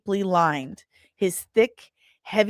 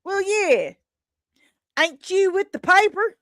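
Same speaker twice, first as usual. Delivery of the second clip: neighbor voiced much higher, louder; Bob, higher voice; 11 semitones higher, 4 dB louder than usual. Neighbor asks the reporter if she's with the paper, high loud voice.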